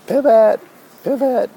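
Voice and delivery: high, loud commanding cry; Effie barking orders. Retold or imitated. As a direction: snap the drill call like a fussy coach. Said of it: "Kinda sounds a little bit like".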